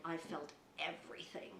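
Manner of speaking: low, hushed retelling; grim confession. Retold or imitated. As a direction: murmur it low, weighing every word. Retold grim account of surgery.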